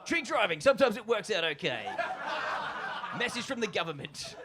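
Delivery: loud, cheery government-ad read; hammed-up announcer tag line, laughing through it; mock ad voiceover. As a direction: announce it like a public service ad. Message from the government.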